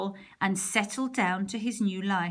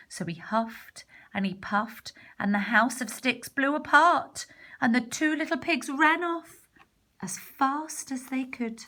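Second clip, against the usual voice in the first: ordinary delivery, nothing marked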